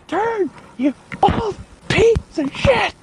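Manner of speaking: high, slow strained curse at the machine; frustrated yell at tractor. Retold or imitated. Buddy cursing the tractor, strained high yell. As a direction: yell at the stubborn old tractor.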